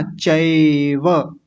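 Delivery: चैव very slow with an open ऐ; correct ऐ sound. Shown as a demonstration. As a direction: lengthen the ऐ in चैव. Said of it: "चैव नहीं कहना".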